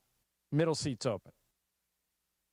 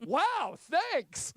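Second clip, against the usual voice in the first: high-pitched mock-grateful woman; hammed-up, high squeal of thanks; delighted thanks; 12 semitones higher than usual. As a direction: gush it high, imitating a surprised passenger. Acts out woman offered the seat.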